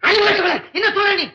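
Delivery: high, agitated telling-off; angry scolding. Acted. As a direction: scold your daughter in alarm. Father scolds daughter. (பதற்றத்தோடு திட்டும் குரல்)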